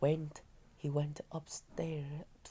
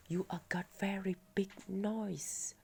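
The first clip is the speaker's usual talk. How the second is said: whispering; 5 semitones higher than usual. A narrator's reading. Girl whispers about nose.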